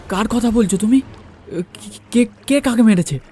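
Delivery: ordinary delivery, nothing marked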